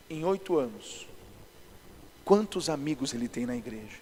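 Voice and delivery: low, soft voice of God; quiet imitation of God prompting the pastor; God speaking. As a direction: speak low and softly, as a word to the heart. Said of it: "Aí Deus falou pra mim assim".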